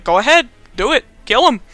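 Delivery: loud, high egging-on; imitated jeering spectators; mock crowd goading. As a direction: urge them on like a rowdy crowd. Crowd eggs Bonesaw on.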